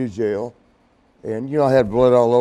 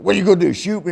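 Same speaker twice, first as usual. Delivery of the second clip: high, raised-voice dare; retold angry challenge to an opponent; pissed off; 6 semitones higher, 6 dB louder, much faster than usual. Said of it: "I was still pissed off".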